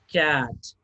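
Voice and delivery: ordinary talk, nothing marked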